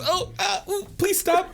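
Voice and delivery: high falsetto whimpering plea; comic high-pitched begging; pleading Moo. Acted. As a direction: beg in a squeaky, pained voice. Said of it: "And Moo goes".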